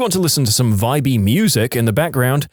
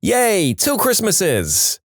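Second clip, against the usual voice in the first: mock yell; 9 semitones higher, much slower than usual. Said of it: "he literally screamed".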